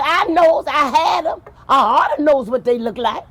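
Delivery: wife indignantly telling the king she bore them; angry wife. Acted. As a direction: snap back at the king, offended. Wife snaps back angrily.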